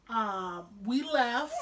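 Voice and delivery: usual speaking voice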